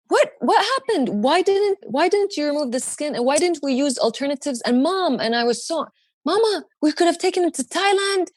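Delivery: high, frantic pleading with her parents; a hammed-up disbelieving rant at mom and dad; frantic outburst. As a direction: fire off the questions at a stunned family. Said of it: "I went crazy".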